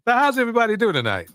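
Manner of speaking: high, fast, bright hello; excited greeting. Real, not acted. Host greeting viewers as the stream starts, excited and fast. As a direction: burst in like a talk show host.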